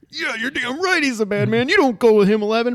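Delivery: high, loud shout at the TV; yelling at the screen. Retold or imitated. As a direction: warn her off at full voice. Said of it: "And I was like".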